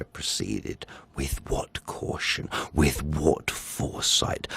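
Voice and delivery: soft conspiratorial whisper; sly whispered pride. Acted. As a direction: lean in and whisper the boast. Boasting of his caution.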